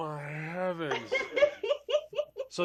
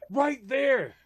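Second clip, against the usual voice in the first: louder and higher; urgent pointing; 8 semitones higher, 8 dB louder than usual. Retold or imitated. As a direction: call out where it is. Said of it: "she's like".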